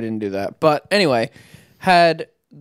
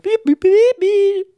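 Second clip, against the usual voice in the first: high-pitched imitated robot beeps; squeaky electronic beeps done by mouth; robot beeping; 5 dB louder, slower than usual. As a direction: beep loudly like a little machine. Imitates robot being steered inside.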